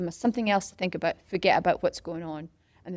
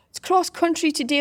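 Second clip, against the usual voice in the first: bright, higher announcing voice; teacher voice; 9 semitones higher, 4 dB louder than usual. Retold or imitated. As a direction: call it out like a PE teacher. PE teacher announcing cross country.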